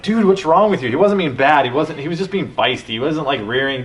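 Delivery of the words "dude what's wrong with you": higher, louder, fed-up snap at the horse; exasperated outburst. Retold or imitated. Rider fed up with her feisty horse, exasperated outburst. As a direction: let the frustration burst out at him. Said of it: "I was like".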